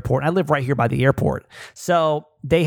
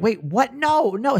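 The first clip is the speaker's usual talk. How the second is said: retold startled protest; 7 semitones higher, slower than usual. Retold startled denial to accusation.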